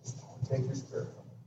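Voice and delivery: whispered plea. Acted. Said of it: "like David said".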